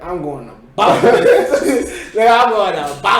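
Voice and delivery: loud, high and laughing; retold excited boast. Speaker retells calling a friend about switching schools, excited boast. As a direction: hype up a friend loudly. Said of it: "I called my dog Monk and was like".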